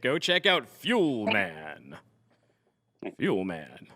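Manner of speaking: slow, very low, softened hero voice; superhero announcer. Pretend. Host plugging the company like a superhero, deep slow announcer. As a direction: sink into a drawn-out, deep mock-heroic plug. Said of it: "it's like a superhero".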